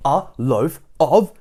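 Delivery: loud, slow, separated words; shouting. A narrator's reading. Mister Noisy at the top of his voice, shouting. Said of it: "shouting at the very top of his voice".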